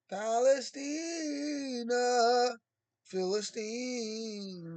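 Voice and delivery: Palestina and Philistina stretched very slowly, syllable by syllable; drawn-out sounding out. Shown as a demonstration. Sounding out word variants.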